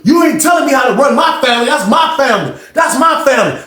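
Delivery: high, heated shouting at a rival; angry yelling. Retold or imitated. Banks yelling at KJ about his family, furious shout. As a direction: tear into him, voice up high.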